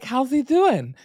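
high, slow lisping voice; a drawn-out goofy falsetto; silly put-on voice. Host voicing neighbor Roy asking about the husband, goofy lisp. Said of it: "You got the lisp and everything".

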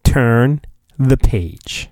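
normal talking voice

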